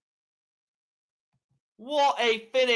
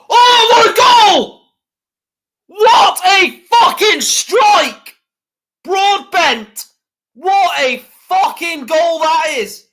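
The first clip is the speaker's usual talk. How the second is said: screaming, much higher and louder; ecstatic goal shout; 9 semitones higher, 11 dB louder, slower than usual. Real, not acted. Fan watching his team score a screamer, ecstatic shouting. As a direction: roar it like your team just scored.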